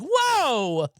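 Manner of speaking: loud high whoa; sudden surprised exclamation; hammed-up startled whoa; mock surprise. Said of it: "I'm like".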